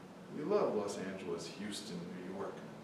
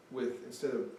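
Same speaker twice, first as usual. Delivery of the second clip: ordinary talk, nothing marked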